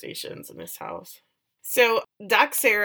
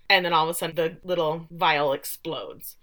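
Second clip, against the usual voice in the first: ordinary delivery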